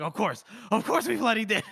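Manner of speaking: high, exasperated outburst breaking into laughter; upset corporal. Retold or imitated. Corporal answering his recruit about the rope, upset. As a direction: voice a fed-up corporal, pitch climbing. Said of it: "He's upset".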